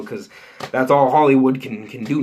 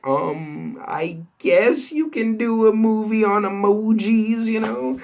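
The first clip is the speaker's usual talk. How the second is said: slow, high, doubtful put-on voice; doubtful shrug; 9 semitones higher, slower than usual. Telling viewers about the Emoji Movie, mildly doubtful. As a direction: drag it out like you're not convinced. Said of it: "I was like".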